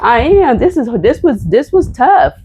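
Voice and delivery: real tears pushing the pitch up; getting emotional. Real, not acted. Reading her pardon aloud, she chokes up near tears. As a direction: voice breaking high with tears. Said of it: "sorry, I'm getting emotional".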